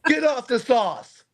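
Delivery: high, drawn-out mock yell; playful high-pitched telling-off; mock scolding. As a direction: jokingly holler it at the dog, high and slow. Mock scolding the drunk-sounding dog.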